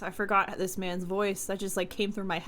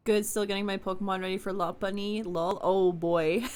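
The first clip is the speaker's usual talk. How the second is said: ordinary talk, nothing marked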